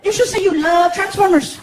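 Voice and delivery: high-pitched eager suggestion; Frankie. Acted. Frankie angling for Transformers gifts, high-pitched. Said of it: "Frankie walks into the kitchen".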